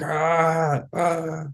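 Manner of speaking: two high, held "ah" cries, kept soft; imitated screaming. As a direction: mimic distant screams without the volume. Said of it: "like I was screaming".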